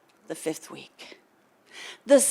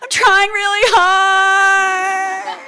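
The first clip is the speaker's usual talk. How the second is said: slow, high, loud cry of effort; tearful shout; 11 semitones higher, 10 dB louder, much slower than usual. Retold or imitated. Answering the instructor, a high slow tearful shout. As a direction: cry it out desperately. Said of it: "it's what I said".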